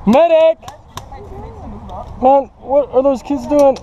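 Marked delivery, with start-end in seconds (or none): 0.1-0.6 loud call for a medic; man down shout. Real, not acted. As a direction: yell for the medic. Calling for a medic.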